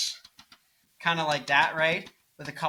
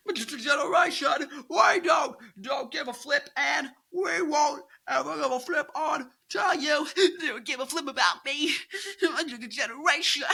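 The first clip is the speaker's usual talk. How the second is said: mock yelling quote; 10 semitones higher than usual. Reviewer mocking a lyric with a high yelled rant. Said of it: "she said".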